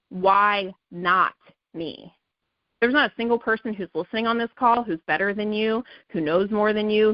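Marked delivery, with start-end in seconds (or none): 0.1-2.1 "Why not me?" drawn out very slowly, each word weighted; slow emphatic mantra. Shown as a demonstration. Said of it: "I need you to start asking".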